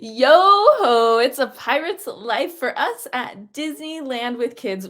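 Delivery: loud hearty pirate bellow; hammy pirate greeting, arms wide. Pretend. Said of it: "We're celebrating Talk Like a Pirate Day".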